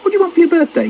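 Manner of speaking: put-on high-pitched small child's wheedle; little boy coaxing. Small boy interrupting brother's chant, high coaxing voice. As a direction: pipe up high, trying to distract.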